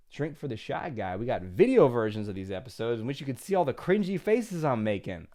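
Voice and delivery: usual speaking voice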